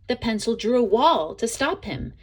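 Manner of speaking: usual speaking voice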